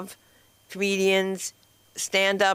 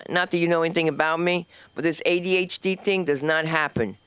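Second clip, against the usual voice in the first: usual speaking voice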